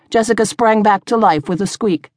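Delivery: plain reading voice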